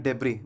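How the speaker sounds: डेब्री ending on ee; silent s. Shown as a demonstration. Teacher says how to speak it, डेब्री with silent s. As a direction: leave no s on डेब्री. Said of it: "यहाँ पर एस silent होता है".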